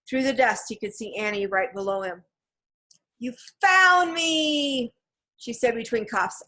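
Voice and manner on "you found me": very slow, drawn-out high call; loud wail of relief; relieved cry. A narrator's reading. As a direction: cry it out loud, stretching FOUND.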